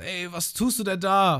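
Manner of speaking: high, slow, alarmed voice; Lysop imitated. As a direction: protest in mock alarm. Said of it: "Und der Lysop dann so". (hohe, erschrockene Stimme)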